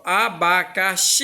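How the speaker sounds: abacaxi with the final "xi" drawn out long and forceful; stressed final syllable. Shown as a demonstration. Teacher showing pupils the tonic syllable of abacaxi, stretched final "xi". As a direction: lean hard and long on the last syllable of abacaxi. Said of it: "Eu pronuncio com mais força".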